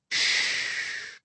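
long soft shh; hushing shh. A narrator's reading. Sister silencing her brother near the shack, hushing shh. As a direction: hush someone with a drawn-out hiss. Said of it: "to silence me".

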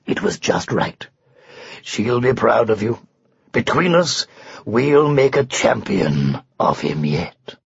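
low, unhurried lion voice; reassuring lion. Acted. Lion plots with the unicorn to make a champion, low and reassuring. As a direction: reassure in a deep lion's register.

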